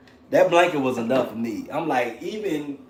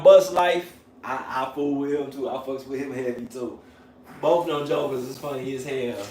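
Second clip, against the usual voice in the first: ordinary talk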